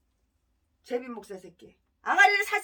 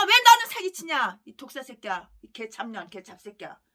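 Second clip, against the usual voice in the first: ordinary delivery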